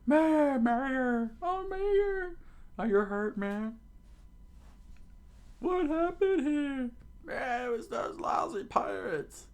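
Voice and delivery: very high falsetto wailing; alarmed townspeople. Acted. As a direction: fret over the mayor in a squealing put-on voice.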